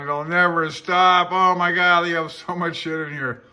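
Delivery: exasperated outburst. Real, not acted. Overwhelmed by the huge handbook, loud exasperated outburst. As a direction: throw up your hands at the endless book.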